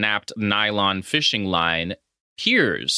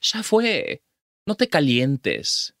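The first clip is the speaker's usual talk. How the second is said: smug dismissal; 3 semitones higher, slower than usual. Retold or imitated. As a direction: talk down to him calmly. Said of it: "He ends this conversation by saying".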